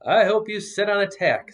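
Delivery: put-on high, loud childish jab; boy snapping at the friend who dropped him, high and loud; spiteful kid retort. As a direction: hurl it at Paul like a sulky boy. Child angrily cursing his friend.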